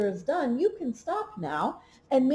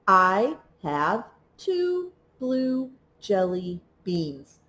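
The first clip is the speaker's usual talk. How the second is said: slow and even, one word per tap; slow word-by-word reading; 4 semitones lower, much slower than usual. Shown as a demonstration. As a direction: read it slowly, tapping each word for a child. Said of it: "read the sentence one last time, tapping each word".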